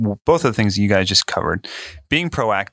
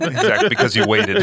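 laughing; 11 semitones higher than usual. Real, not acted. Teasing about the late-night paper.

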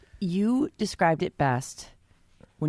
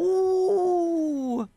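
high squeal; 12 semitones higher than usual. Pretend. Mock delight at Friday.

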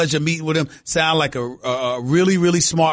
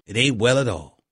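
softer mock Southern accent; 8 semitones lower than usual. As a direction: echo "It ain't well at all" quietly and lower, keeping the Southern accent. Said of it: "with my regular Cowboy accent".

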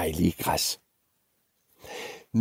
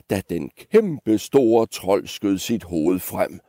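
plain reading voice

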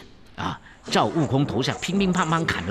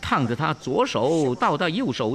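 ordinary delivery, nothing marked

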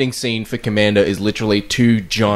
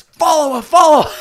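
much higher, louder urgent repeat; hammed-up action hero barking orders; mock Arnie urging; 6 dB louder than usual. Arnie urges the girl to chase the car, mock loud. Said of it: "And he just goes".

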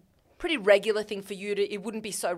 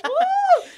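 high whooping cheer; excited woo cheer; 11 dB louder than usual. Real, not acted. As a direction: whoop with delight. Cheering at date news.

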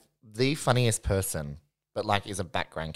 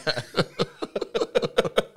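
high laugh; 11 semitones higher, 4 dB louder than usual. Real, not acted. Laughing at drag queen story.

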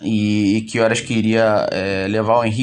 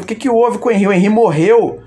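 retold panic, higher and louder, voice rising in dread; panicked question; 8 semitones higher, 4 dB louder, faster than usual. Father reliving the call about his son, panicked and higher. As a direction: ask as if fearing the worst about your son.

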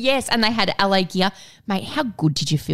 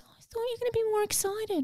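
much higher, softer mum voice; mum let down; 9 dB quieter, faster than usual. Retold or imitated. Imitating her mum hurt by the lukewarm thanks, high and soft. And she goes.

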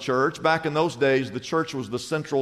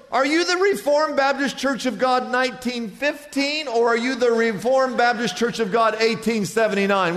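high-pitched acted question; hammed-up eager passerby; 7 semitones higher than usual. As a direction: press on excitedly for common ground. Passerby presses ever narrower question.

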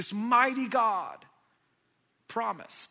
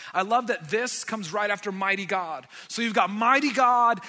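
normal talking voice

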